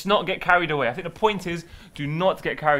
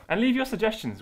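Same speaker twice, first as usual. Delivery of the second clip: normal talking voice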